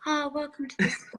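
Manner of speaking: mock spa receptionist greeting a guest; a lightly put-on soothing spa staff welcome. Spa staff greeting an arriving guest, in spa voice. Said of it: "Spa voice".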